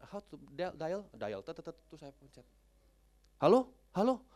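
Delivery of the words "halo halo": loud, high hello into a dead line; calling into phone. Retold or imitated. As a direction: call out to check the connection. (seruan keras di telepon)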